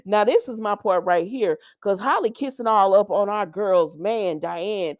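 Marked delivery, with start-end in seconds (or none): none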